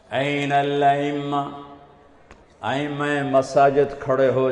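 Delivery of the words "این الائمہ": slow, sustained chant-like call; proclamation. Acted. Announcer summons the imams, slow level proclamation. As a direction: hold the syllables long and level. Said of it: "ایک اعلان ہوگا".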